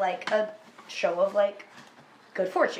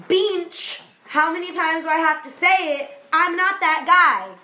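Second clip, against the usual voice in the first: exasperated snap; 7 semitones higher, 6 dB louder than usual. Retold or imitated. Peter denies a third time.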